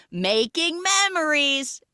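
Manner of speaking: high, slow sing-out; group photo cheer. Retold or imitated. Guests echoing the photographer, drawn-out cheer. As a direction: stretch it out like posing guests.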